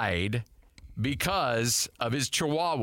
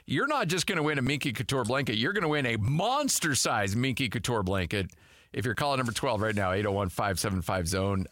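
radio contest promo; 4 semitones lower than usual. Acted. Giveaway announcement continues.

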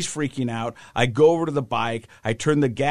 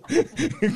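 real high giggle mid-story; laughing; 13 semitones higher than usual. Real, not acted. Dad tickled by his son, real laughter. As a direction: crack up between words.

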